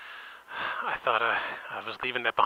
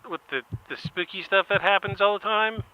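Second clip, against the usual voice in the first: exasperated explaining; 6 semitones higher, 4 dB louder than usual. Retold or imitated. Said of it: "I'm like".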